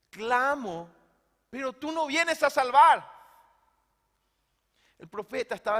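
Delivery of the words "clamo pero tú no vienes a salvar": anguished cry. Acted. Prophet's cry.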